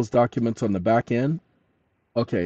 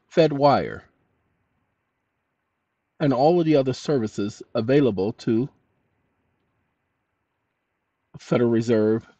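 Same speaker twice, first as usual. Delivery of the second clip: dictating. Shown as a demonstration. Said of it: "Now, watch this".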